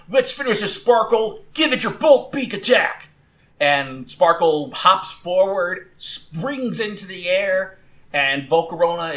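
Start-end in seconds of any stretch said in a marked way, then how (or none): 0.1-3.1 high, excited battle command; calling out. Acted. Trainer orders Sparkle to attack, calling out high. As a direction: call the move out to your fighter. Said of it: "Ethan calls out".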